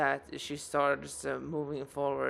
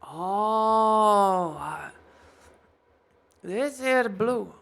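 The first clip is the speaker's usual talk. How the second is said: higher, louder helpful shopkeeper; dwarf smith voice; 4 semitones higher, 5 dB louder than usual. Acted. Dwarf explaining blue bullets to customer, higher and louder. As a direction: explain the glimmer cheerfully. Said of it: "GM as Goldrin explaining".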